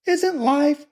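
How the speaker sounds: very high falsetto, left unfinished; faltering girl. A narrator's reading. Laura stammering to her brother, high and faltering. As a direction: falter mid-thought in a girlish put-on voice. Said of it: "she stammered".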